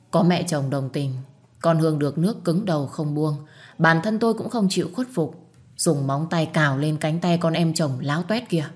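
plain reading voice

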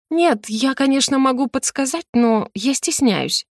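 высокий кокетливый голосок, с заминками; разыграй стеснительность, протяни последние слова; притворная стыдливая девственница. Pretend. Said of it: "играть я роль стыдливой девственницы". (жеманно, притворно стыдливо)

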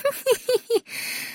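a high, soft giggle; high creature laugh. A narrator's reading. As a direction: giggle lightly like a small creature. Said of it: "Зверушка рассмеялась".

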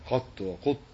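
قط وقط said as qattu, then qutt, with doubled t; variant forms. Shown as a demonstration. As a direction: contrast the a and u vowels in قط وقط. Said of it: "وأما قط ففيها لغات".

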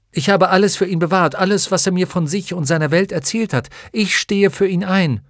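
put-on high, loud, fast pleading boy; earnest boy. A narrator's reading. Atreju vouching for his friend, high and loud.

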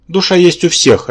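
miffed objection; offended. A narrator's reading. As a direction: insist as if slighted. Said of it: "обиделся Рабан".

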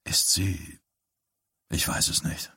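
hushed whisper between father and son; a secret exchange once the mother has left. A narrator's reading. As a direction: whisper so she can't hear.

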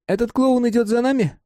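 put-on high boyish voice; Mark's voice. A narrator's reading. Mark telling Simon it was fun, high boyish voice. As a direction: speak as the young friend Mark.

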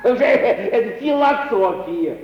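slow, mocking laugh through the words; laughing scoff. Young man mocking the lady's companion, with a slow laugh. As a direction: laugh off her idea as mere philosophy.

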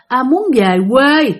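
drawn-out, slow grandfather's voice; lightly voiced old man, slowly. Possessed grandfather answering his daughters, slow old voice. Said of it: "giọng ồn ồn như là đàn ông lớn tuổi".

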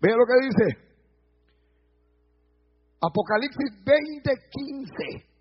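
ordinary talk

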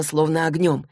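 plain reading voice

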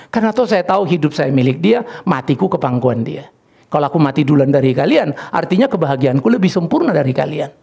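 ordinary delivery

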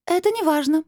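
high voice turned cold; icy crystal voice. A narrator's reading. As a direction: dismiss it in a high, icy ring. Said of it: "Голос похолодел, словно покрылся льдинками". (высокий голос, холодный как льдинки)

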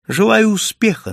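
teasing, derisive send-off; mocking. A narrator's reading. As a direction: wish luck with open derision. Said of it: "насмешливо произнёс я".

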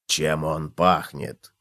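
low, slow, soft question; sniffing cockroach. A narrator's reading. Cockroach smells the pot, curious. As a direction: wonder slowly in a deep quiet voice. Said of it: "принюхался таракан".

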